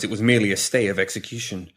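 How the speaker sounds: plain reading voice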